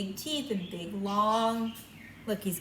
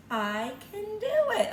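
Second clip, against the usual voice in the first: put-on proud little girl; 10 semitones higher, 4 dB louder than usual. Girl answers in picture book.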